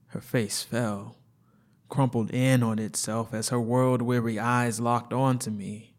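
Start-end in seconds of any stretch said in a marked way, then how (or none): none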